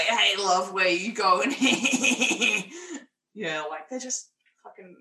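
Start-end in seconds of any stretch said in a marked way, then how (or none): 0.0-1.5 loud leering catcall in a put-on voice; mock bus stop creep. Mocking the movie's bus stop thugs, loud leering catcall. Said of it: "just be like". 1.6-3.0 laugh. Real, not acted. Laughs after impression.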